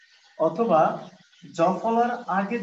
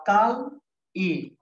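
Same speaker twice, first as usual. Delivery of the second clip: কাল pitched up and loud, then ই apart; slow motion split; 2 semitones higher, 3 dB louder, much slower than usual. Shown as a demonstration. Teacher splitting Kali slowly to show the sound. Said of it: "slow motion".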